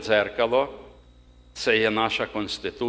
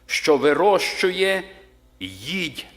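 slow, higher, louder reading of the Bible verse; solemn scripture reading; 3 semitones higher, 4 dB louder than usual. Acted. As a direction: read it out like a preacher proclaiming the Word, slowly. (урочисте читання Писання)

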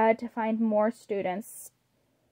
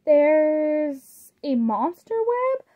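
slow, amazed, a touch higher; surprised gasp; 4 semitones higher, much slower than usual. A narrator's reading. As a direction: gasp it out in wonder. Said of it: "Draculaura gasped in surprise".

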